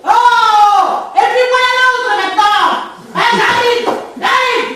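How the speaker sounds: high, very loud shouted answer; loud calling out. Acted. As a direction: holler that you are on your way. Flustered, shouting that she is coming. (crier très fort, voix aiguë)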